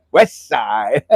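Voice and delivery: "West side" laughed out in Hawaiian pidgin; Hawaiian accent. Retold or imitated. Host copies how local friends say "West side", Hawaiian pidgin. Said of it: "Make sure you put that accent to it".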